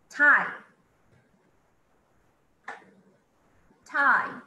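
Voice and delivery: Tie called out loud; loud model word. Shown as a demonstration. Teacher calls out Tie loudly for students. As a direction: project Tie to the class. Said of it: "model word".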